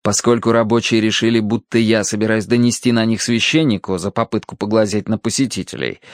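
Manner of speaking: ordinary delivery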